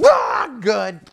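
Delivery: high yelp breaking the sentence; mock startled yelp; yanked mid-word. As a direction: cry out as the rope jerks. Yanked mid-thought by the rope.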